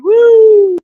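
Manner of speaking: high loud whoop; alarm shout. Pretend. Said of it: "Sound the alarm".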